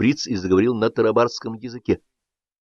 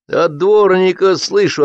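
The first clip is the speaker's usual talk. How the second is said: snapping back; 9 semitones higher, 4 dB louder, slower than usual. A narrator's reading. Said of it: "огрызнулся дачник-огородник".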